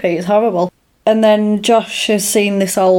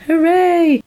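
mock cheer; 9 semitones higher than usual. Cheering the happy ending.

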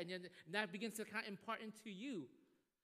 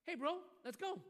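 coworkers' invite; 10 semitones higher than usual. Retold or imitated. Coworkers urging the mentor to come along, high and pushy. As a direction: call it out like an eager buddy.

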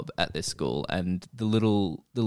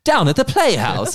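old-timey Boston accent, higher and louder, hammed up; 10 semitones higher, 7 dB louder than usual. Mimicking the show's kids for friends: old-timey Boston accent. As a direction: put on a hammy old-timey Boston accent like a sixties TV drama. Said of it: "that's a good accent".

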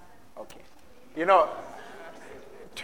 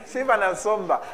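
laughing; 5 semitones higher than usual. Real, not acted.

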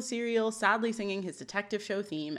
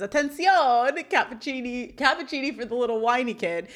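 put-on Italian accent, stretching the first word; 3 semitones higher, 3 dB louder than usual. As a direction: call out like a showy fake Italian. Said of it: "in his Italian accent says".

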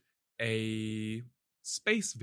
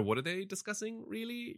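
baffled; 9 semitones higher, 5 dB quieter than usual. Retold or imitated. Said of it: "you're like".

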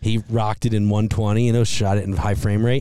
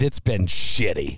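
blunt; 5 semitones lower, slower than usual. Retold or imitated. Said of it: "start it off with".

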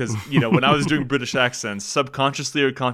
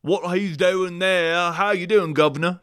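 higher, drawn-out mock Cockney; Cockney accent; 5 semitones higher than usual. Shown as a demonstration. Co-host shows what Cockney sounds like, hammed-up Cockney accent. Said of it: "what's a Cockney accent".

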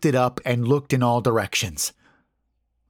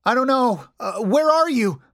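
high-pitched, frightened calling out; fearful; 4 dB louder than usual. A narrator's reading. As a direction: call into the dark with fear in it. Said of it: "noticeable trace of fear in it".

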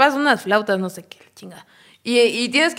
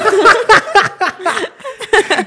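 high laugh; 9 semitones higher, 6 dB louder than usual. Real, not acted. Laughs at joke.

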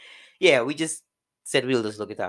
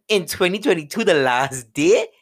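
loud, high disbelieving cry; disbelief; 4 semitones higher, 7 dB louder than usual. Retold or imitated. Driver after a crash on New Year's Eve, loud disbelief. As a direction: exclaim it like you can't believe your luck. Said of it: "And I was like".

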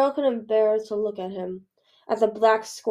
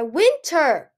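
loud, alarmed call; raised, carrying cry; shouted name; 6 semitones higher, 9 dB louder than usual. A narrator's reading. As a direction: shout it across a distance. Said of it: "a voice shouted from the sky".

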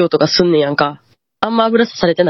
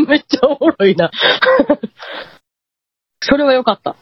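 laughing; 6 semitones higher than usual. Real, not acted. Laughs at listener's reaction.

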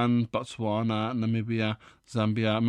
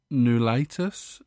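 ordinary talk

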